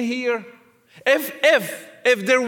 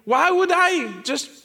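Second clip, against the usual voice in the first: ordinary delivery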